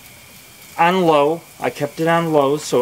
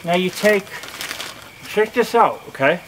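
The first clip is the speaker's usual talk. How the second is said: cigar in mouth; 3 semitones higher than usual. Real, not acted. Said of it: "my voice sounds weird when I got the cigar in my mouth".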